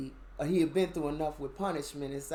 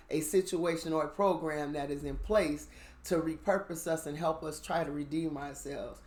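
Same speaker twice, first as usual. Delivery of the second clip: usual speaking voice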